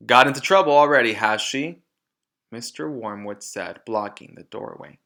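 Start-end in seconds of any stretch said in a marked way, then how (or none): 0.1-1.8 much louder and fast, scornful; sneering loud father. Acted. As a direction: fire it off with scorn.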